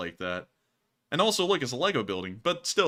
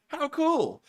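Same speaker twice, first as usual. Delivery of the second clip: excited; 5 dB louder than usual. Real, not acted. Excited at the donor's memberships.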